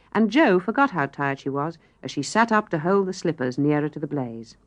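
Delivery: plain reading voice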